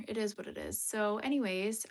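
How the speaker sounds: ordinary delivery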